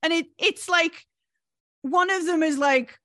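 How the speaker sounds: ordinary talk, nothing marked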